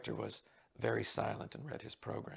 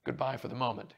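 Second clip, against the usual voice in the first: raised-voice farewell; calling out; 9 dB louder than usual. A narrator's reading. Man calling back while hurrying his friend out, raised voice. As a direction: call back as you leave. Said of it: "he called".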